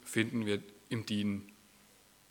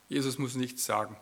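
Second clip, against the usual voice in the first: usual speaking voice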